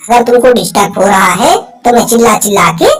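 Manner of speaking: put-on loud boyish voice; loud kid answer; cartoon boy. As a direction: call out like an eager schoolboy.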